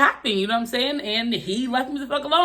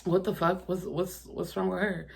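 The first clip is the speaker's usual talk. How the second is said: muttered to herself; 8 dB quieter than usual. Retold or imitated. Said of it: "but I was just like".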